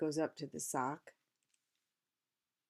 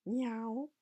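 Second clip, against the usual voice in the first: cat meow; 7 semitones higher, 4 dB louder than usual. A narrator's reading. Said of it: "he called down".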